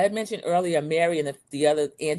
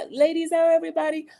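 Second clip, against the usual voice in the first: high, fast hospitable welcome; cheery greeting; 11 semitones higher, faster than usual. Retold or imitated. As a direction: greet the ladies warmly like a host. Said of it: "So he greets everybody".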